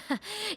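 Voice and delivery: quick high awkward chuckle; nervous laugh. Acted. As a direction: laugh to cover being caught.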